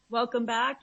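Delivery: normal talking voice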